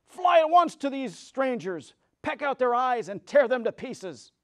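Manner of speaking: high, loud witch's order, easing off; witch commanding crows. Acted. Witch sends the King Crow off, high and loud. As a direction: shriek the order, then settle. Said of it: "the Wicked Witch said to the King Crow".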